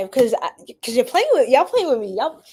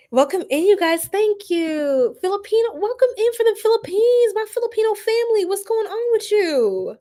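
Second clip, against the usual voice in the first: very high, squealing singsong greeting; excited welcome; 11 semitones higher, 3 dB louder than usual. Real, not acted. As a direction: gush hello, pitched way up, stretching the ending. Welcoming viewers from the Philippines.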